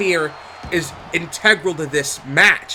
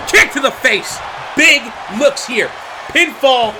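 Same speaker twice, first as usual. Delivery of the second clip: excited count; 5 semitones higher, slower than usual. Real, not acted. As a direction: build the near fall tension. Near-fall excitement.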